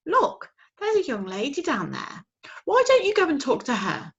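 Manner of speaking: sleepy mother owl. Acted. Mother owl advising her owlet. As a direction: soothe her child.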